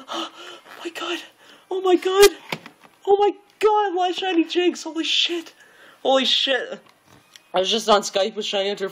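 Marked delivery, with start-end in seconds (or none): 0.1-6.8 gasp, then squealing much higher; overwhelmed, high-pitched joy; real excitement. Real, not acted. Streamer showing chat the shiny Jinx, squealing.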